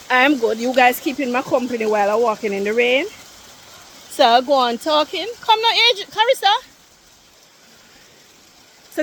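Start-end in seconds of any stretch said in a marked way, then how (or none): 5.4-6.6 calling out. Real, not acted. Calling to friends ahead.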